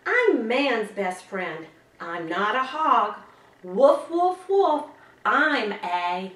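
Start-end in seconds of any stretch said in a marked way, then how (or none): 3.6-4.8 high, louder playful barking; mock dog bark. To the child, a dog riddle clue, high playful woofs. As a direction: bark like a happy puppy. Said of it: "animal riddles that you might like to do with me".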